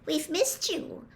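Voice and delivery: put-on high, slow, timid girl's voice; small, high, hesitant plea; tremulous. A narrator's reading. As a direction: say it tenderly, voice wavering. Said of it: "said Hermione tremulously".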